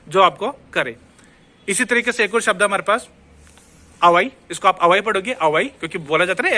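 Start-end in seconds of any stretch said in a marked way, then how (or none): 4.0-6.6 अवाई as "a-waa-ee", no H; silent H. Shown as a demonstration. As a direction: begin अवाई straight on the vowel. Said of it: "एच तो silent है".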